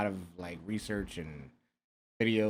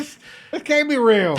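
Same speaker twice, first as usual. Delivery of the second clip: high, loud can't be real; disbelief; 12 semitones higher, 6 dB louder than usual. Real, not acted. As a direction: cry out in disbelief. Disbelief at cursive letter.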